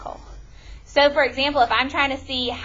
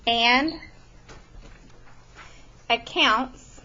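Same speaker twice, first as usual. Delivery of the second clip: word by word with long pauses; feed the definition slowly for writing; dictating a definition; slow dictation; 6 dB quieter, much slower than usual. Shown as a demonstration. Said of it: "A statistical question".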